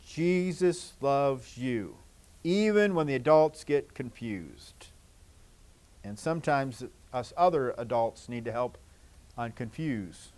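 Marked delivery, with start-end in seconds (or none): 0.0-4.9 tender reassurance. Real, not acted. Reassuring children in sermon.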